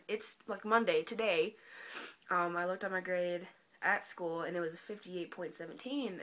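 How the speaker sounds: normal talking voice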